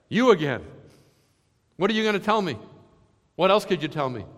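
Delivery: weary dread. Retold or imitated. Hezekiah voiced, dreading Isaiah.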